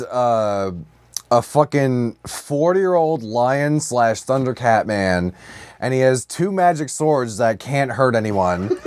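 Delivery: normal talking voice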